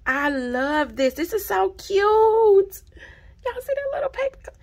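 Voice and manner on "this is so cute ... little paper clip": much higher and louder, gushing; squealing delight. Real, not acted. Vlogger showing viewers the paper clip, squealing. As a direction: squeal at the cute thing.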